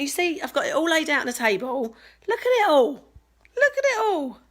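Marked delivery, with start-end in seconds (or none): 2.3-2.9 excited. Real, not acted. Showing off the gifts table. 2.9-4.4 excited. Real, not acted. Showing off the gifts table.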